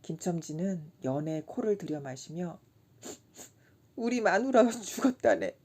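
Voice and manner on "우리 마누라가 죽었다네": sniffling grief. A narrator's reading. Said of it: "울기 시작하였다".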